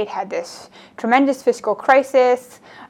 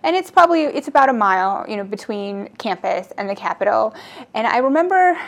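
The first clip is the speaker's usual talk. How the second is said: ordinary talk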